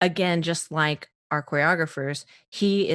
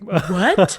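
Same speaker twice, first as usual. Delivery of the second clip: shocked what; 7 dB louder than usual. Retold or imitated. Casting director call, shocked.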